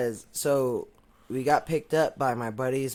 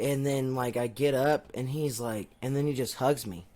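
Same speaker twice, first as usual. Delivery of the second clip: ordinary talk, nothing marked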